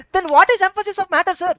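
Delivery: high-pitched imitated query; questioning student. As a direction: lightly act a student challenging the teacher. Acts out a questioner addressing sir.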